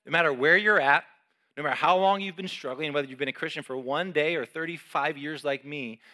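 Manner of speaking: preaching at full force; fervent appeal. Acted. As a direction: plead with the struggling listener.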